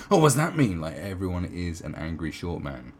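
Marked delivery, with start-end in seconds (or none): 0.1-0.8 louder, higher offended snap; mock offended. Mocking a triggered person, offended and loud. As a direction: bristle like someone easily triggered. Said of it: "someone going".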